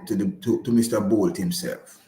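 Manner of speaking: ordinary delivery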